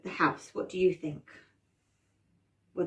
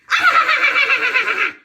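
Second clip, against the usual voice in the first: high, loud witch cackle; witchy laugh; 11 dB louder than usual. Acted. Witch traps the children, high witchy cackle. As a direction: cackle wickedly at the top of your voice. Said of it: "with a lovely witchy laugh".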